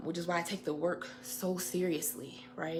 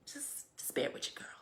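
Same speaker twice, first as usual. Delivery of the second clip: sheepish whisper; 9 semitones higher, 5 dB quieter than usual. Real, not acted. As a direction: whisper an apologetic plea. Sheepish whispered request to viewers.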